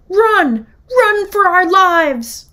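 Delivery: high, loud alarm cry; urgent shout. Acted. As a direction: yell the alarm to flee. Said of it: "The duck called up".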